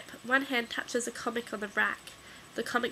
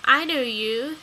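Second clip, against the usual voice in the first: put-on lightly whiny kid; 2 semitones higher, 8 dB louder, slower than usual. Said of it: "a whiny voice says in your ear".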